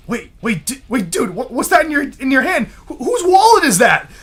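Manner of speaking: high, rising alarm and disbelief; startled. Retold or imitated. Suite mate reacts to the wallet.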